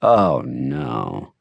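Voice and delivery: brief, low groan of dismay; abysmal groan. A narrator's reading. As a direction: groan it far down in your register. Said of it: "My groan was brief but abysmal".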